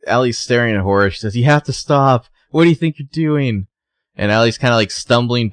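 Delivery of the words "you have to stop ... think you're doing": retold alarmed protest. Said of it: "screaming for her to stop".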